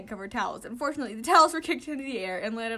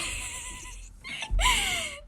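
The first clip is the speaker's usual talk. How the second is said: long high-pitched giggle. Real, not acted. Reader laughs alone.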